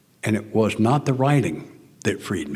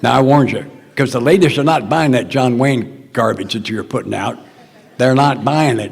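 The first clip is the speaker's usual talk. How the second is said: much louder and higher, teasing the men; loud playful warning; 4 semitones higher, 8 dB louder than usual. Real, not acted. Warning the gentlemen about women, loud and laughing. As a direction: drive it home loud with a laugh.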